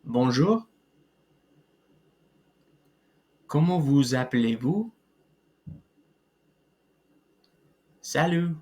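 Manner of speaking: French phrases, each said clearly for repeating; careful teacher model; model pronunciation. Shown as a demonstration. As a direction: pause after each to let students repeat. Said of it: "practice some pronunciation".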